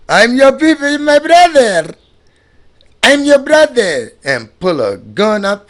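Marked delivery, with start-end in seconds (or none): 0.1-4.1 high, put-on friendly voice, a little louder; smooth-talking fake brother. Mocking the outsiders who claim to be your people, high and put-on. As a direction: sell the brotherhood with a false grin. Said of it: "They be talking about they your people".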